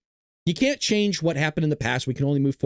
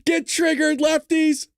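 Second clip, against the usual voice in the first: taunting; 12 semitones higher, 3 dB louder, slower than usual. Acted. Taunting viewers.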